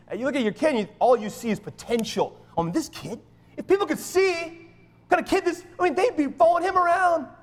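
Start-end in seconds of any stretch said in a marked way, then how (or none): 3.6-7.3 high, excited parental raving; gushing proud parent. Acted. Pastor imagining everyone following his kid, gushing. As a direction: rave about your kid, pitch soaring.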